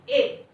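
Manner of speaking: ए as a short vowel sound; the short vowel ए, crisply demonstrated. Shown as a demonstration. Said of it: "sound क्या आता है".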